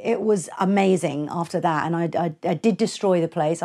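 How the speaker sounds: normal talking voice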